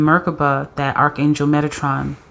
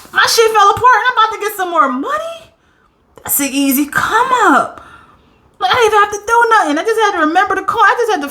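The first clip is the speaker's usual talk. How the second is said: high, squealing delight, racing at the end; can't contain the excitement; thrilled. Real, not acted. Tarot reader to viewer, squealing over good cards.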